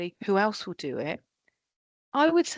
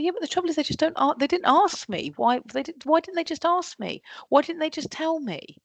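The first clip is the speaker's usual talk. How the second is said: higher, rapid, whining blame; exasperated complaint; 7 semitones higher than usual. Retold or imitated. Leader complaining that staff never asked, high fed-up whine. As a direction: sound like a fed-up leader moaning about staff.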